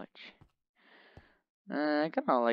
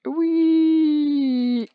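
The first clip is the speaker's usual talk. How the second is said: playful squeal; 8 dB louder than usual. Acted. Squeal while falling.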